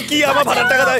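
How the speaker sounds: usual speaking voice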